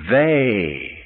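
"They" said as "Vay", drawn out; V sound. Acted. Rabbi answering the students' question, accented V sound. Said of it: "the rabbi looks and he says".

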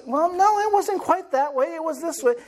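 correcting spouse. Retold or imitated. Spouse interrupting the other's story, mock-correcting it. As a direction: playfully cut in to set it straight. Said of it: "referenced the stories last night".

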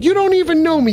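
high, wounded ghoul cry; hurt whine. Acted. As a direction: plead like a hurt misfit monster.

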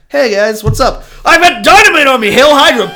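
high, loud, fast cheerful announcement; breezy greeting turning to a fanatic cry; manic bomber. Retold or imitated. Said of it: "Walks in and says".

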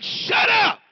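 high, loud mock-exasperated yell; exasperated shout. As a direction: play Jesus yelling to silence his bragging friends. Jesus silencing boastful disciples.

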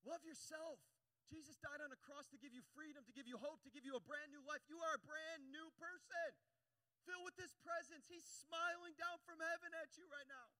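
high, loud, building exhortation; impassioned preaching. Real, not acted. Preacher encouraging church to love themselves, impassioned. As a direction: build to a stretched, ringing declaration.

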